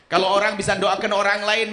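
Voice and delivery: ordinary delivery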